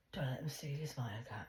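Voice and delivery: whispered, voice dropped low; mocking whisper. Mocking the demonologist's advice in a whisper. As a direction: hiss it like a sneering aside.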